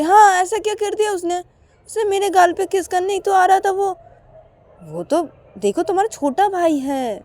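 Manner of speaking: put-on high voice siding with the boy; sister-in-law taking the brother's side; sister-in-law defending. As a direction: defend him lightly. Shreya defends Rahul.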